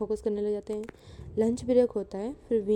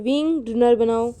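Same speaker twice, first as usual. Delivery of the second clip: loud, slow aunt's command; bossy order; 7 dB louder, slower than usual. Acted. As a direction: call it out like an order. Aunt ordering Wing. (Aunty wing को dinner का हुक्म देती हैं)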